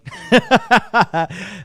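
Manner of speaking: bursting, high-pitched laugh; real laugh that breaks out loudly. Real, not acted. Laughing after imitating the Australian intern, loud burst.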